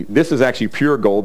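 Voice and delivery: ordinary delivery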